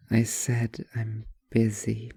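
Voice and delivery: slow, low, flat-out annoyed; irritated repeat. Acted. Writer repeating herself to the waitress, slow and low.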